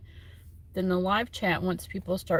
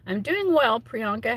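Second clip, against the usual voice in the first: cheerful; 6 semitones higher, 4 dB louder than usual. Real, not acted. Answering a viewer cheerfully.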